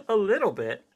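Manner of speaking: high laughing disbelief; mock outrage at the number; amused disbelief. Real, not acted. Cohost reacting to the unibrow result, amused and incredulous.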